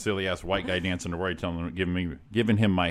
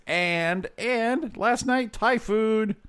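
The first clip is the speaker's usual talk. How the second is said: drawn-out excited buildup; 12 semitones higher, much slower than usual. Real, not acted. Teasing buildup to food news.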